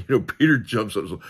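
ordinary talk

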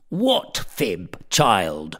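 a stern tutor pouncing eagerly on the word; sharp, eager schoolmaster demand. A narrator's reading. Thwackum pounces on Blifil's accusation, eager and stern. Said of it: "cries Thwackum pretty eagerly".

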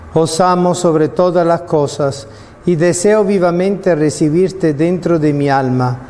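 ordinary talk, nothing marked

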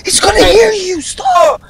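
high, urgent squeal; panicked pleading. Real, not acted. Panicking dad will hear teammate.

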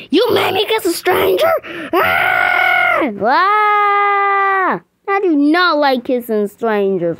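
high, loud shrieks of disgust; loud hammed-up screaming; mock horrified scream. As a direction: scream like the kiss was a horror. Made to kiss a stranger.

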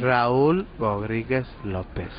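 slow, over enunciated name; spelling it out slowly. Shown as a demonstration. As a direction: draw out each syllable of the name. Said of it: "así hablando despacito". (deletreando despacio)

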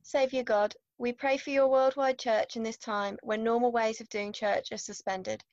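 ordinary delivery

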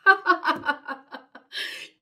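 delighted high giggle; giggling. Acted. Piggy laughing at the reader, gleeful giggle. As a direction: burst into glee.

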